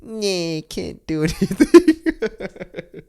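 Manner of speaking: put-on high, whiny sick-baby voice, laughing through it. To his wife, whining like a baby when sick. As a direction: whimper like a helpless sick baby. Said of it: "I'm like a baby".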